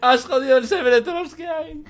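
said through laughter; laughing. Real, not acted. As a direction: laugh it out teasingly. Laughing repeat of remark.

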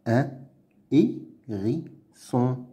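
"Un hérisson" slowly as "un", "hé", "ri", "sson"; pauses between all syllables of "Un hérisson". Shown as a demonstration. Teacher sounding out words to read, "Un hérisson" in slow pieces. Reading drill, split then blended.

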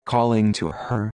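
usual speaking voice

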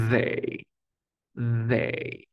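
"they" with a buzzing, voiced th; vibrating th. Shown as a demonstration. Teacher models "they" for students, th voiced and buzzing. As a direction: let the th in "they" vibrate between tongue and teeth. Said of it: "Hay una vibración".

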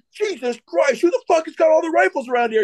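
high, fast exasperated outburst; mock exasperation. Staff sergeant ranting about missing rifles, high mock exasperation. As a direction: imitate a sergeant losing patience, voice shooting up.

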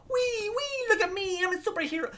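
squeaky high-pitched mock hero voice; mock superhero. Fan mocking a wrestler's superhero act in a squeaky voice. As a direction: tease her as a giddy superhero, very high. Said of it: "doing the whole".

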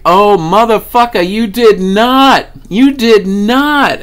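hooting and hollering. Retold or imitated. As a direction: whoop it at your friend in gleeful disbelief.

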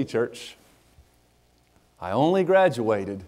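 ordinary talk

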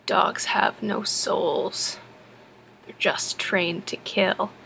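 put-on slow, low, whispered creepy child; slow eerie whisper of a strange kid. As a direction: lean in and breathe it ominously. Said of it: "he leans in, and he goes".

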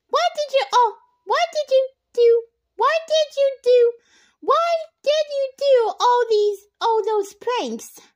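high loud urgent demand. A narrator's reading. Nancy confronting Bradley about the pranks, loud and high. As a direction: press him hard for the truth.

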